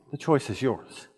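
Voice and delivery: ordinary talk, nothing marked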